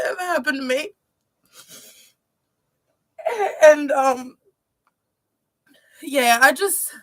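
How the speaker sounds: real tears breaking through the words; choked up crying. Real, not acted. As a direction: voice catching on held-back sobs. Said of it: "sorry, I get so emotional".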